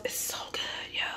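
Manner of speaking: savouring whisper over a bite; hushed mouthful of food. Real, not acted. Savouring food while eating.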